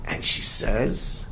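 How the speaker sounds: ordinary delivery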